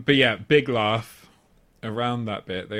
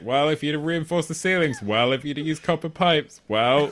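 raised, louder lecturing tone; smug scolding; 6 semitones higher, 5 dB louder than usual. Retold or imitated. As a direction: scold the manager smugly. Imitating Whitey to hotel manager.

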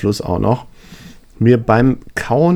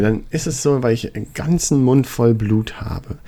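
ordinary delivery

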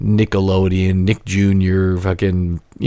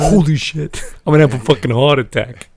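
put-on kid voice like John Travolta; 5 semitones higher than usual. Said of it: "I'm doing that voice for that kid".

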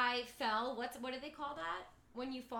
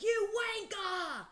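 high, loud, drawn-out British jeer; mock British scream; 9 semitones higher, 6 dB louder, slower than usual. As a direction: scream abuse in a put-on British accent. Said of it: "He's kinda screaming at him".

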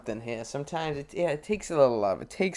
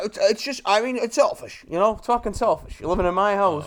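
higher-pitched, put-on indignant whine; mock complaining father; 6 semitones higher than usual. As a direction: play an aggrieved dad scolding his son.

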